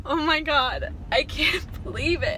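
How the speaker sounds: crying while talking, voice high; choked-up happy tears; snotty crying. Real, not acted. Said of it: "ugly crying".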